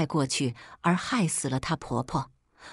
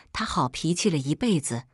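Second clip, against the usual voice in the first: ordinary delivery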